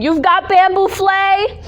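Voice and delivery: high, loud, slow, alarmed mother; imitating mom. Impersonating her mom reacting to an illness, high and slow. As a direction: play the mother horrified at the diagnosis.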